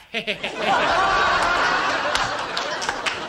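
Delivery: real laugh; cackle. Real, not acted. Laughs at own joke.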